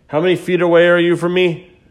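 high, loud call across the house; yelling across the room. Real, not acted. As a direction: raise your voice to reach another room. Calling wife across the house.

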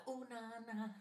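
high playful sing-song with a laugh; teasing sing-song name. Retold or imitated. Teasing coworker with a nickname, sing-song. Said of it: "So I'd always be like".

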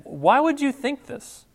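a king doubting the servant; incredulous. Acted. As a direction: ask it as if you cannot believe it.

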